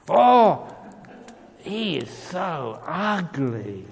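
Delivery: loud, high "oh", then drawn-out words; mock revulsion. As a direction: exaggerate the disgust, stretching each word slowly. Acting out a snap perception.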